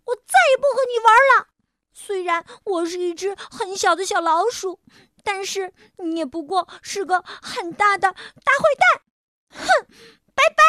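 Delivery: loud, high, fast angry rant; angry yelling. Acted. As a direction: shout him off in fury. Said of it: "我气得大叫起来". (小老鼠气急大叫)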